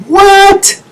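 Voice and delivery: very high-pitched mock disbelief; incredulous outburst. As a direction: let it leap way up in disbelief. Exaggerated disbelief at ridiculous demands.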